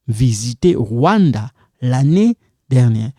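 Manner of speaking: normal talking voice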